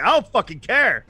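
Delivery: high, loud mock outburst; exasperated retort. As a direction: imitate a fed-up citizen cutting the king off. Complainer cuts the king off.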